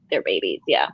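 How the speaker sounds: ordinary talk, nothing marked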